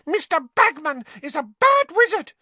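high, loud indignant elf voice; squeaky elf, cross; angry house-elf. A narrator's reading. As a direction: elf denouncing a bad wizard. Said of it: "she looked angry again".

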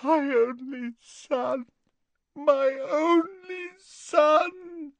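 high, slow, grief-stricken wail; sobbing mother. A narrator's reading. Narcissa sobbing at Snape's feet for her son. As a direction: draw it out as she weeps.

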